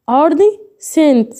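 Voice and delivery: أرض سنت split in two, slowly, with a pause before سنت; slow word demonstration. Shown as a demonstration. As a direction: say أرض, pause, then a clear سنت with no k sound. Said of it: "هو أنا هنا لما نطقتش السي".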